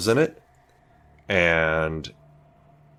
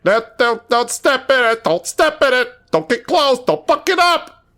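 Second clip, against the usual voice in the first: frantic shouting; 6 dB louder than usual. Acted. Frantic guards warning players off.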